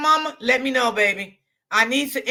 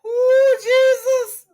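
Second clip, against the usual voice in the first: high, laughing through tears; weak with laughter; 9 semitones higher than usual. Real, not acted. Laughing helplessly at what happened on the live. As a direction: barely get the words out for laughing. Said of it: "I was so weak".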